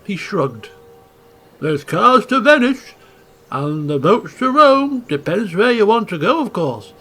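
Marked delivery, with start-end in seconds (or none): 1.6-6.9 put-on a light Italian accent, louder, as the sailor; lightly voiced Italian seaman, shrugging; hint of an Italian accent, casual. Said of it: "Italian-speaking, uh, sailor from the accent".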